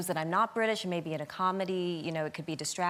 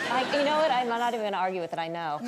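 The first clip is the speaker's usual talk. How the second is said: usual speaking voice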